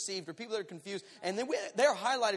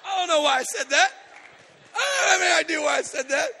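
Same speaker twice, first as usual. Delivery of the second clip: exasperated shout; 9 semitones higher, 13 dB louder than usual. Acted. Preacher amazed at his own words, comic shouting. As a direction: yell it, baffled at yourself.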